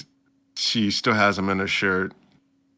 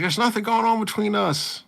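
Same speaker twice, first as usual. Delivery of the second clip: put-on high flustered girl; hammed-up flustered anime girl; mock flustered denial; 13 semitones higher, 3 dB louder than usual. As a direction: deny it in a squeaky rush. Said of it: "she's like".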